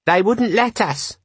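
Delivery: put-on high-pitched little boy's voice; high, drawn-out child's voice; small boy. A narrator's reading. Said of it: "said a small boy holding a bucket".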